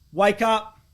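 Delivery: ordinary talk, nothing marked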